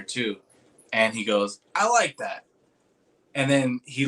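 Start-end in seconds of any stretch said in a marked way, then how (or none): 1.7-2.4 high, put-on impression; raised-pitch imitation of an approving reply; Todd. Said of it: "he goes, in his voice".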